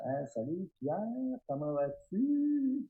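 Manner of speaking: drawn-out greeting climbing very high; mock sweet greeting. Comedian calling Pierre for help, sing-song mock casual. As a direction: greet sweetly, stretching the last words upward. Acts out phoning imitator for help.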